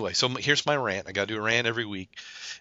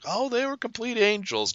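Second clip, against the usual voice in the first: high, sweet, gushing voice; gushing relative; 12 semitones higher than usual. Retold or imitated. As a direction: coo it like a doting grandma. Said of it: "And they go".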